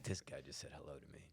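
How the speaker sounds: quick, hushed aside into the phone; whispered aside. Retold or imitated. Man complains to his caller about a stranger, whispered aside.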